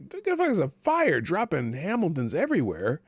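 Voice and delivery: high excited announcement; excited shout. Real, not acted. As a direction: hype up the tipper.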